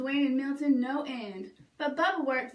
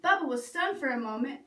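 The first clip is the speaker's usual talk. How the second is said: ordinary delivery, nothing marked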